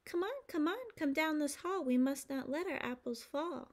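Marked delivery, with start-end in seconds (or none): none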